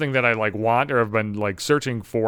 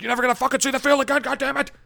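coach yelling; 11 semitones higher, faster than usual. Retold or imitated. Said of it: "I was like".

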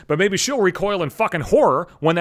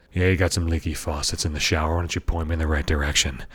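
very low, cheesy porn-actor voice; porn plumber; 13 semitones lower than usual. Acted. Plumber asking about leaky shower faucets, mock-seductive.

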